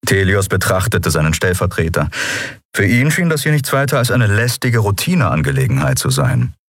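plain reading voice

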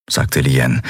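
ordinary delivery

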